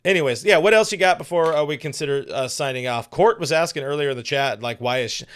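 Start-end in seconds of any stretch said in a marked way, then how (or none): none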